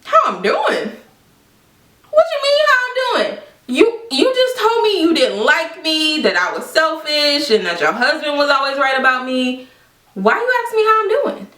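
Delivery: high-pitched indignant comeback; incredulous outrage. Retold or imitated. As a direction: throw the question back in disbelief. Outraged reply to friend's greeting.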